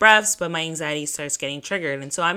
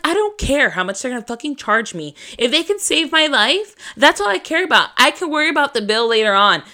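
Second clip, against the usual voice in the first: re-enacted frantic, high-pitched arguing; freaking out; 6 semitones higher, 5 dB louder than usual. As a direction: argue back in a raised, panicky voice. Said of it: "I was, like, freaking out".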